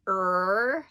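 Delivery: "Er" with the tongue curled back, said loud; retroflex er. Shown as a demonstration. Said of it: "you put your tongue in the back".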